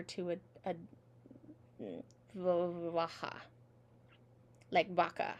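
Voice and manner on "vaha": vaha with the V pulled back very soft. Shown as a demonstration. Teacher showing the softest variant, pulled-back V. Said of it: "pull it back even softer".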